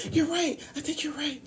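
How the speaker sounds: small, high, hushed giving in; a timid high voice that gives up; meek high whisper of agreement. Retold or imitated. Woman answering Shaitan's whisper, meekly agreeing in a high hush. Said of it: "you fall right in line".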